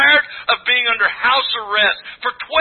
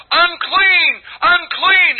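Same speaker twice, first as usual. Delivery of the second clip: raised, higher call of the outcast; mock yell; 7 semitones higher, 3 dB louder, much slower than usual. Preacher voicing the unclean woman calling out, raised mock yell. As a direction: cry it out like a warning to the street. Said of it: "she had to yell".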